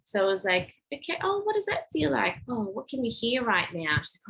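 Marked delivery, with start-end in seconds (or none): 0.9-4.0 put-on much higher, sing-song wonder to distract a child; "does" lifted high in a playful child-directed tone; bright coaxing voice. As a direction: lure her attention with delight. Redirecting girl with bright questions.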